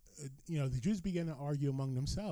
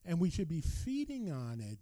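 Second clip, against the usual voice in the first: ordinary talk, nothing marked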